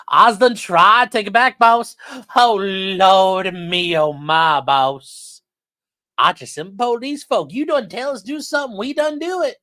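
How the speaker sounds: hammed-up Southern plea, boss; mock Southern accent, climbing very high; John Coffey Southern accent. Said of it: "I was doing more of a John Coffey accent".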